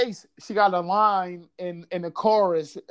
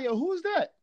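eager question; 10 semitones higher, 4 dB quieter than usual. Retold or imitated. Friend asking again, pitched up.

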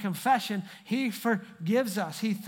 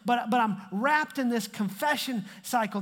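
normal talking voice

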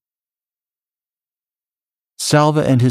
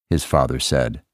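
usual speaking voice

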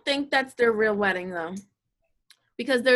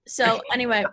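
ordinary delivery

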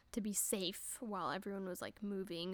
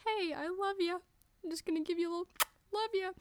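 cooing at the cat; 10 semitones higher, 3 dB louder, faster than usual. Retold or imitated. Speaking sweetly to her cat.

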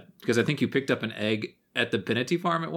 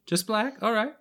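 surprised barmaid; 8 semitones higher than usual. Acted.

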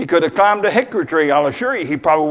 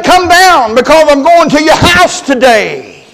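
slow, loud, higher commanding call; 9 semitones higher, 11 dB louder than usual. Retold or imitated. Jesus summoning Zacchaeus down, slow and loud. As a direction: proclaim it like a summons. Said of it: "But he said".

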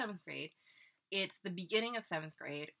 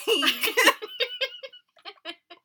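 high loud cackle; shrieking laugh; 14 dB louder than usual. Real, not acted. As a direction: burst out laughing. Big laugh.

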